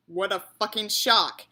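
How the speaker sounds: usual speaking voice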